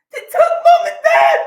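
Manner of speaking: high, loud wailing repeat; anguished cry. Retold or imitated. Sister breaking the news, wailing high and loud. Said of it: "She said".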